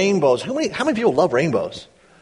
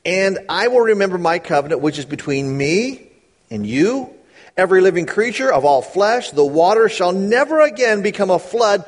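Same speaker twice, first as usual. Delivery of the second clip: raised, measured Bible reading; scripture reading; 5 semitones higher, 4 dB louder than usual. Acted. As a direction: read the verses out with weight. Reads scripture rising.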